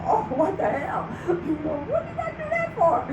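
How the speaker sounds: high, laughing mock dismay; laughing regret. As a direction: squeal it through laughter, sorry you did it. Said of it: "then I'm like".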